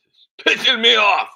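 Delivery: high, loud Yankee grumble; grouchy old Yankee, annoyed; old New England accent. Pretend. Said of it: "doing my Yankee voice here".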